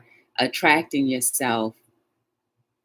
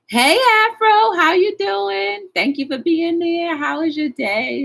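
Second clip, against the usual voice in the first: much higher, louder, slow sing-song hello; high greeting; 6 dB louder than usual. Real, not acted. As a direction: sing out a welcome to the viewer. Greets viewer joining live chat.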